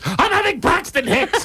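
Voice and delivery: high, drawn-out hammed-up wail; mock labor panic. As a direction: imitate a wife crying out in labor. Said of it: "now she starts".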